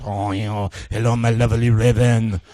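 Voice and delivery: low, smooth put-on French accent; mock French charmer's accent. Stage magician wooing his volunteer, low French accent. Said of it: "the French accent".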